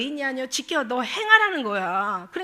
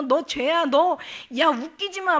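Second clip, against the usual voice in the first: louder, raised jeer of an accuser; mock frantic defiance; 3 semitones higher, 6 dB louder, slower than usual. Preacher voicing evil spirits accusing believers, loud mock defiance. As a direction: lightly act out a spirit lashing out. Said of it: "막 얘네들은 막 발악을 한다니까".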